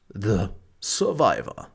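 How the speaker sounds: slow dramatic trailer announcer. Pretend. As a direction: intone the title like a trailer. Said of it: "Muss ja die Trailerstimme machen".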